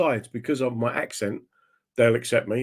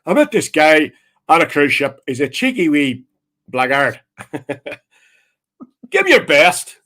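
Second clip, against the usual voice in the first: broad Northern Irish compere, raised voice; Northern Irish host; 3 semitones higher, 5 dB louder than usual. Retold or imitated. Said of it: "your man hosts it going".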